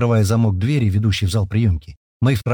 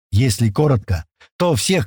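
ordinary delivery

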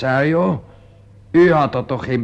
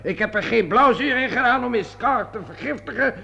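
indignant outburst; 9 semitones higher than usual. Acted. Indignant denial, then leaves.

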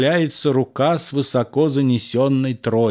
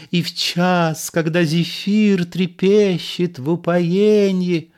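higher, theatrical declaiming actor voice; pompous stage recitation; 7 semitones higher, 3 dB louder than usual. Acted. Montfleury playing Phedon on stage, higher hammy declamation. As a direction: declaim it like a vain ham actor. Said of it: "начинает роль Федона".

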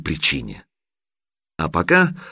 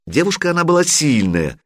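plain reading voice

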